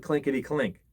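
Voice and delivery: plain reading voice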